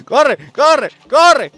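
loud urgent yell; urging shout. Real, not acted. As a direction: shout at yourself to run. Urging himself in the race.

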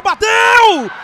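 roaring goal call; goal shout. Real, not acted. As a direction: explode on the goal.

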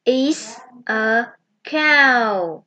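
This is a cow said very slowly; teacher modelling the sentence slowly for children; slow model sentence. Shown as a demonstration. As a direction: stretch each word for kids to copy. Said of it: "One more time".

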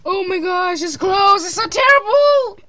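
loud, high wail of dismay; dismayed exclamation. Real, not acted. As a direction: cry out at the closed video. Sister reacts to closed video.

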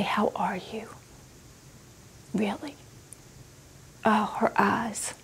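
hushed whisper. Real, not acted. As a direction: whisper it softly, moved. Moved recollection of a kind stranger.

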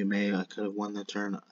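ordinary talk, nothing marked

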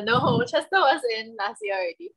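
normal talking voice

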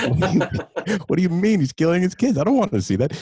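high-pitched, amused disbelief; mock alarm. Husband reacting to wife's shocking recommendation, mock-alarmed.

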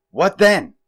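loud, high-pitched alarmed question; worried wail. A narrator's reading. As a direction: demand it in a worried cry. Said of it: "she wailed".

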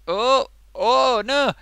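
high, loud yelp of surprise; sudden loud surprised cry; startled. Real, not acted. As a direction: react as it springs open. Lipstick suddenly pops open.

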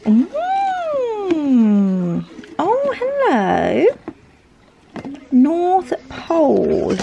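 high, slow drawn-out delight. Real, not acted. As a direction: savour the reveal with wonder. Delight opening advent gift.

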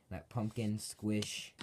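ordinary talk, nothing marked

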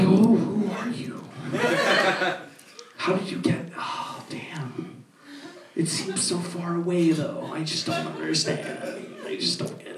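hushed, spaced-out murmur trailing away; mock awestruck whisper at the cooler; dazed stoned whisper. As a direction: drift off in a hushed stoned haze.

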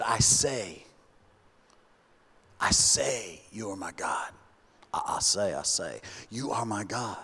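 low, soft, pressing himself to say it; quiet self-command; hushed insistence. Shown as a demonstration. Preacher voices David forcing the words. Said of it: "having to tell his mouth what to do".

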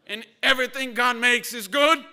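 army dad pressing his point to his son; stern army father. Retold or imitated. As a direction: lecture like a general. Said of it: "he's kinda like a general".

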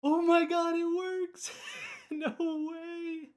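real ecstatic disbelief; thrilled laughing shout. Real, not acted. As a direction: shriek with giddy joy.

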